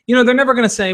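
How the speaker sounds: ordinary delivery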